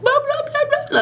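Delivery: high-pitched mock blubbering; imitated crying babble. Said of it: "like, crying and stuff".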